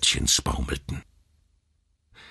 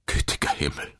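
whispered, aghast exclamation; horrified murmur. A narrator's reading. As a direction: breathe it out without voice, aghast. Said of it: "murmelte er". (entsetztes Murmeln)

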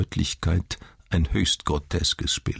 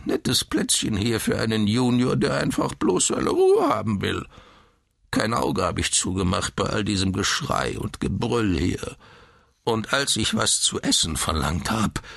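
higher, indignant grizzling; whining old man; 6 semitones higher than usual. A narrator's reading. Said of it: "greinte der Alte". (empört greinender Alter)

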